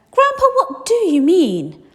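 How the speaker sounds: high, loud pleading question; eager boy. Acted. Charlie pressing his grandfather, high eager boy voice.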